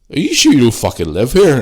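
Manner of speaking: loud, high incredulous question; mock disbelief. Local lad reacts to his street knowledge, loud mock astonishment. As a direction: imitate a lad who can't believe it.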